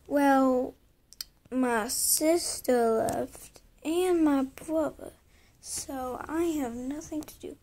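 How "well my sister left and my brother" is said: normal talking voice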